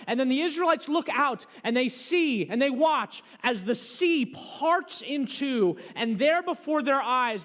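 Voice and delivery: dramatic preaching. Acted. Preacher narrates the parting sea, loud and slowed for awe. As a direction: paint the sea crashing open.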